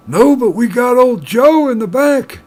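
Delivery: put-on high, loud, slow old-timer bartender. Bartender telling the miner about old Joe, high and slow. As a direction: drawl it out like an old barkeep. Joke, bartender answers again.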